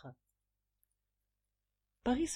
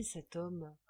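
usual speaking voice

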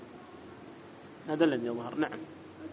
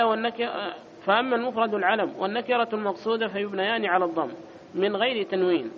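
raised, louder recitation of the book; reading the text aloud; 5 semitones higher, 4 dB louder than usual. Acted. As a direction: read the passage out like a formal recital.

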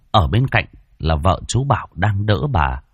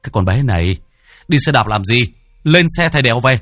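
urgent teacher's scolding, climbing higher and louder; sharp scolding cry; 4 semitones higher, 4 dB louder, faster than usual. Acted. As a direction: order the pupil sharply, voice rising. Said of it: "Thầy giáo tôi thấy vậy thì rít lên".

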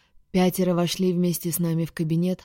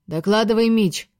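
higher, louder call to report; commanding order; 5 semitones higher, 6 dB louder than usual. A narrator's reading. Commander to the duty officer, commanding. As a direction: issue a sharp military order. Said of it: "приказал он дежурному офицеру".

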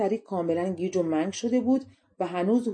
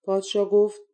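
ordinary delivery, nothing marked

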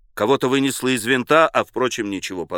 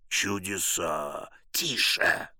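low, very soft aside; hushed; 6 semitones lower, 10 dB quieter, much slower than usual. A narrator's reading. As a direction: murmur it under your breath. Hushed remarks in the crowd.

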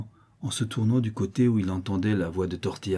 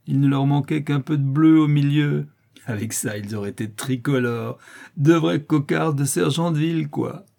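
put-on high, loud jeering boy; taunting young rascal; 7 semitones higher, 7 dB louder than usual. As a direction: crow the joke in a raised voice. Tortillard mocks the brigand.

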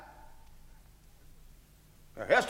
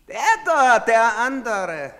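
pointing out; 4 semitones higher, 8 dB louder, slower than usual. Acted. Soldier pointing out the man.

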